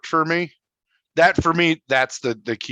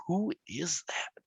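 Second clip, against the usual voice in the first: slow, hushed whisper of disbelief; whispered wonder; 5 semitones higher, 13 dB quieter, slower than usual. Retold or imitated. Moviegoer puzzling over a surprise face, whispered wonder. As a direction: whisper it slowly, as if baffled in a theater.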